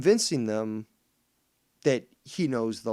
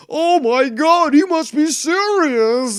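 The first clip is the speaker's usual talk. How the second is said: much higher, louder and slow, hammed-up shock; mock gasping awe; 13 semitones higher, 10 dB louder, slower than usual. Mocking Scott's believers, squealing slow fake shock. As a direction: squeal it like a starstruck dupe. Mocking gullible viewers, high.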